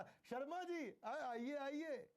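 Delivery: high friendly welcome, Sharma ji; lovingly calling out. Pretend. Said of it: "आपने उन्हें प्यार से बोला".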